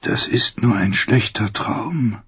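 dazed thought, slow and hushed; thinking aloud. A narrator's reading. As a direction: murmur it to yourself like a bad dream. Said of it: "dachte sie".

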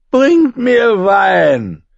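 put-on high, slow drunken call; drunk knight calling. A narrator's reading. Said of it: "rief er".

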